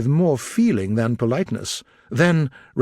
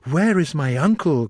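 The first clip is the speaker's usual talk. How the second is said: put-on higher, anxious young woman's cry; Elizabeth crying out; 5 semitones higher than usual. A narrator's reading. Elizabeth anxiously asking after her uncle, raised voice. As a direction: call out for her uncle in alarm. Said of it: "cried Elizabeth".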